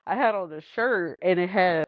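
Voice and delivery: normal talking voice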